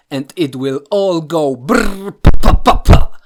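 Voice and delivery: loud mouth-made rapid gunfire; gunfire sound effect. Retold or imitated. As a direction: spit out bullet noises. Imitating bullets speeding up.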